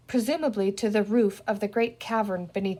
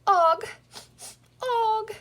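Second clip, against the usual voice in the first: much higher, broken crying voice; tearful; 10 semitones higher, much slower than usual. Acted. Little man crying, pointing at Penny, sobbing. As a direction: sob the words out. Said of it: "he said between his tears".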